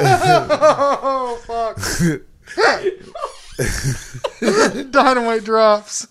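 squeaky laugh, slow words; giggling through the words. Real, not acted. Laughing fit.

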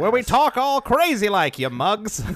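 put-on high, loud old-timey gangster talk. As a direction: talk like a 1950s wise guy. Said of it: "anytime that voice starts".

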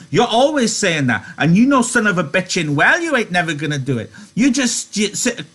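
high-pitched, exasperated tirade; high, sharp character scolding. A narrator's reading. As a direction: lay into him sharply in a high voice. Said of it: "she said sharply".

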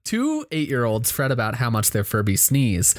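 usual speaking voice

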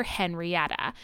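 normal talking voice

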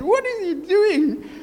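imitating his companion's high, drawn-out cry; alarmed. Companion to man leaping from car, alarmed.

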